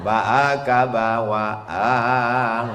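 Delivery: Baaka bawaang with the aa vowels stretched long and slow; drawn-out Minang. Shown as a demonstration. Preacher teases the congregation that Minang has long vowels too. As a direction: linger on each aa in Baaka bawaang. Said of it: "Bahasa Minang ada pakai intonasi panjang".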